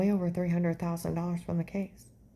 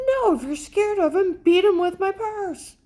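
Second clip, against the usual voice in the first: much higher, louder scornful comeback; defiant retort; 13 semitones higher, 6 dB louder than usual. Retold or imitated. To panicking Dave, mocking defiant shout. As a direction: snap back at him with contempt.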